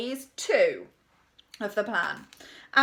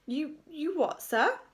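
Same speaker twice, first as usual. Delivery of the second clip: higher, halting question; incredulous; 6 semitones higher, slower than usual. A narrator's reading. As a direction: stammer in feigned disbelief. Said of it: "did her best look of incredulity".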